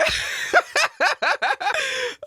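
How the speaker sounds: high, breaking-up laugh; helpless laughter. Real, not acted. Vlogger amused by British humour, helpless laughter. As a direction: burst out laughing, high-pitched. Still cracking up at the clip.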